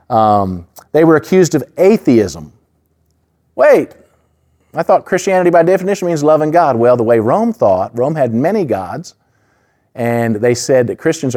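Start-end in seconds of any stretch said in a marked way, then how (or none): 3.5-6.8 high, quick voice of a puzzled doubter; mock puzzled objection. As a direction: play the confused skeptic interrupting. Mock objection from imagined listener.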